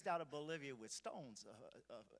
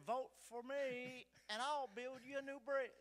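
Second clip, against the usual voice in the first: mock campaign call; 10 semitones higher than usual. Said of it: "And then".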